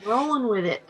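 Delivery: lilting mock contentment; breezy coping act. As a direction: play someone cheerfully putting up with it. Voicing someone in denial.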